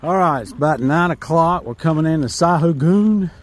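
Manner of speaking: normal talking voice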